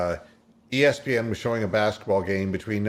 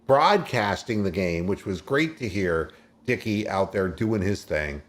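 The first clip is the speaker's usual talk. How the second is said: ordinary talk, nothing marked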